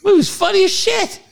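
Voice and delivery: high, squeaky protest that they must leave; a hammed-up version of his own whiny protest; indignant disbelief. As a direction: act outraged at being dragged out. Imitating himself objecting to his friend.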